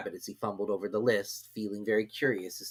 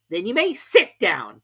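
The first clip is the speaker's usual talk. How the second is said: high, loud, slow dismissal; high loud king; king dismissing; 10 semitones higher, 8 dB louder, slower than usual. A narrator's reading. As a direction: send him off. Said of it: "the king replied".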